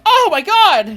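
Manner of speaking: high, very loud crowd panic; horrified scream. Acted. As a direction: scream it like a terrified onlooker. Townspeople freaking out, voiced by GM.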